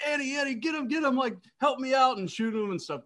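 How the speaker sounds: high, pleading call for help; urgent plea. Retold or imitated. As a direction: beg her to shoot, high and urgent. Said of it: "he's like".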